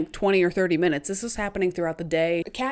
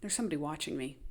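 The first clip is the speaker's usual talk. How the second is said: much softer, lower creepy voice; uneasy hush; 5 semitones lower, 10 dB quieter, much faster than usual. Retold or imitated. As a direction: murmur it like a chill down the spine. Sensing being watched.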